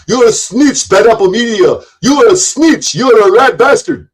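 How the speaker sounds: high, loud sneering taunt; mock the rival's accusation; mocking imitation. Streamer parroting a rival calling him a snitch, mocking. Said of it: "run his mouth and go".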